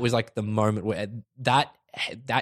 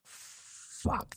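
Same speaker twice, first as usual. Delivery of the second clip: stunned whisper, drawn out; very soft, reenacted amazement; awed whispered curse; 12 dB quieter than usual. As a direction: breathe it out, barely voiced. Retold awed reaction to a film.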